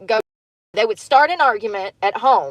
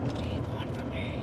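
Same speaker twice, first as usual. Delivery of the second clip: very soft whisper; 15 dB quieter than usual. Real, not acted. Asking God what he wants, whispering. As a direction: whisper it under your breath. Trying out remembered song line.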